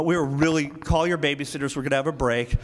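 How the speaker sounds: ordinary talk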